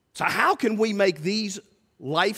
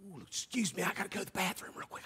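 much softer, fast mock excuse; sneaking away; 15 dB quieter, faster than usual. Churchgoer ducking out to avoid the call, hushed. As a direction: slip out quietly, mumbling an excuse.

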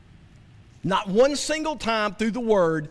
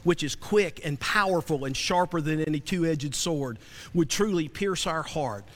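lower, subdued praying voice; earnest prayer; 6 semitones lower, 6 dB quieter than usual. Real, not acted. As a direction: pray humbly to the Lord, kept low. Leads a prayer before preaching.

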